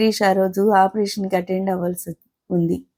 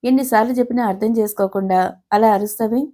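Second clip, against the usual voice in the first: usual speaking voice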